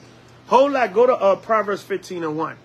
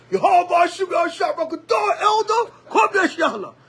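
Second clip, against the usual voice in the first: mock pious brother; 10 semitones higher than usual. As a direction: shout the holy names like a show. Mimics brother's loud camp greeting.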